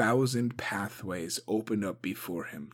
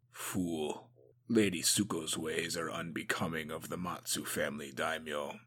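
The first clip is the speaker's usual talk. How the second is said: lower, softer, disapproving; low disgusted mutter; 6 semitones lower, 7 dB quieter than usual. A narrator's reading. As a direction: grumble it under his breath.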